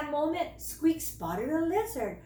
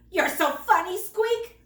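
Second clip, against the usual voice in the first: higher, louder teasing; laughing teasing; 6 semitones higher, 6 dB louder than usual. A narrator's reading. Brothers teasing Squeak, laughing. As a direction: poke fun at little brother. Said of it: "laughed Bruno and Leo".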